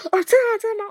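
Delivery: mock surprise. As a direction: play up her own astonishment. Retelling reaction to nurse. (模仿自己惊讶，声音很高)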